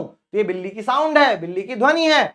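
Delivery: ordinary talk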